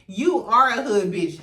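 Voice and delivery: ordinary delivery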